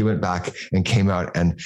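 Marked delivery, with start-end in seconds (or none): none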